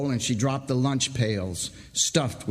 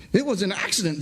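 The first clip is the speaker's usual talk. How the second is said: shaken saw filer; 8 semitones higher, faster than usual. Acted. Saw filer defends himself.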